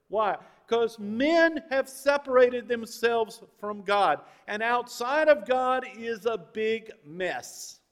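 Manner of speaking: full-force preaching. Acted. Pastor on men separated from God, forceful preaching. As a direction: drive each point home to the congregation.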